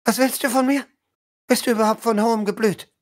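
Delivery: hohe, schnelle herablassende Lady; hochmütige Adlige. Acted. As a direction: mustere die Fremde verächtlich. Spielleiter spielt Lady Lilla. (Lady Lilla verhört Bronwyn hochmütig)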